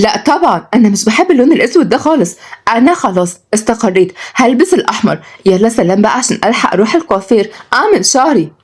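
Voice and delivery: fussy high-voiced granny; lively grandmother. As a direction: refuse firmly in a high, animated voice.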